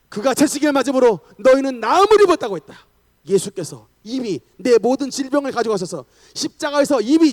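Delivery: commanding shout of exorcism; forceful declaration. Acted. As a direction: rebuke the devil at full preaching force. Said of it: "혜긴 목사님이 바로 선언했답니다".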